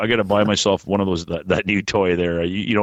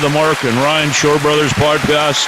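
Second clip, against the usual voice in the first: raised, bright announcer voice opening the show; podcast host greeting listeners; announcer intro; 7 semitones higher than usual. Acted. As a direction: open the show like a radio host.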